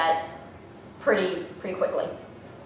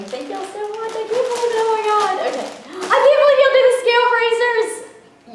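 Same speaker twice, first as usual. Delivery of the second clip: very high-pitched happy squeal, swelling louder; overwhelmed, near tears; 12 semitones higher, 4 dB louder than usual. Real, not acted. Unboxer thanks senders for the scale bracers, tearfully overjoyed. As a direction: squeak out thanks as joy chokes you up. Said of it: "I'm about to start crying".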